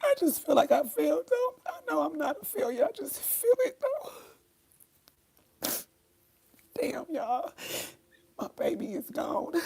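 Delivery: real high-pitched grieving sobs; tearful wail of grief, voice way up high; crying. Real, not acted. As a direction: let the words break into crying. Grieving on stream, audibly crying.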